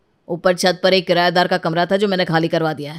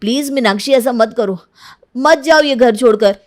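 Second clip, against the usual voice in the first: higher, louder begging; desperate pleading; 6 semitones higher, 6 dB louder than usual. Acted. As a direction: plead with her to stay. Husband begs wife not to leave. (Meenakshi को रोकते हुए गिड़गिड़ाता पति)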